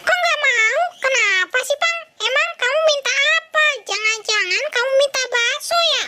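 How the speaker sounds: very high puppet voice; high coaxing voice. Acted. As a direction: coax the sulky friend in a squeaky voice.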